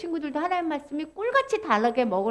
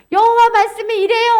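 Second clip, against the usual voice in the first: loud, raised herald's announcement; proclaiming; 6 semitones higher, 7 dB louder than usual. Acted. Prophet delivering God's word to the people, proclaiming loudly. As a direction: call it out to a crowd.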